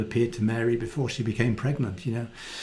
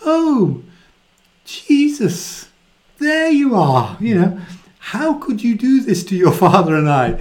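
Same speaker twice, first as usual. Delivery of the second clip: much higher, mock-sweet relief; gentle sing-song higher Mary voice; calm mother; 8 semitones higher, 5 dB louder than usual. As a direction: play the too-calm storybook mother. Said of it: "you don't say it like that".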